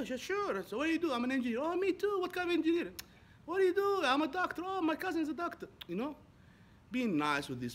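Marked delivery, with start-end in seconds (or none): 0.2-5.7 high, bright retold small talk, much higher; lively chit-chat. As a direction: act out eager cafe chatter, pitched up. Retold upbeat cafe small talk.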